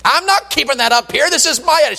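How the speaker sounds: high-pitched mock indignant outburst; hammed-up angry protest, high but not truly shouted; imitated yelling. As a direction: imitate a fed-up woman laying down the law, high. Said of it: "she started yelling".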